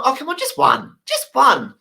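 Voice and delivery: high coaxing voice; pleading Egyptian. Retold or imitated. Egyptian guy begging the German for one, high wheedling. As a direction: coax him with a high wheedle. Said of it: "the Egyptian guy's going".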